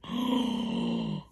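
long amazed gasp. Real, not acted. Staring at her finished flower, gasping. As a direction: drink in the sight with a gasp. Admiring design.

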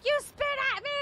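very high, shrieky imitation; mock outrage. Passenger accusing the man who spat at her, mock outrage. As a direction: squeal it in mock indignation. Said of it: "then she starts yelling".

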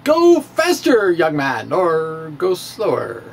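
ordinary delivery

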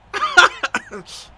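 sudden high-pitched laugh; high burst of laughter. Real, not acted. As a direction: laugh out at a dark joke. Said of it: "совсем грустная шутка".

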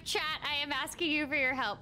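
ordinary talk

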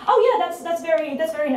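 high-pitched, badly faked British accent. [name] politely answering the bragging Americans, bad British accent. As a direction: put on a poor British accent, pitched well up. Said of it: "I'm doing a bad British accent".

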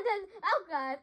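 usual speaking voice

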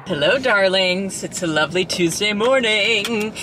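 slow, grand campy drag queen greeting. Pretend. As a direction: sweep in like a camp diva. Mock posh greeting on camera.

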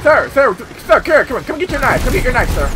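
taunting enemies as a pushy knife seller; mock street vendor. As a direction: pitch your knives like a market seller. Hawking knives at enemies.

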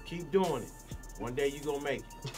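ordinary talk, nothing marked

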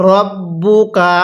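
very slow "রব্বুকা", held double b; model recitation. Shown as a demonstration. Teacher models it for learners, slow measured "রব্বুকা". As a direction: stretch out "রব্বুকা" syllable by syllable. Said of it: "কিভাবে পড়ব".

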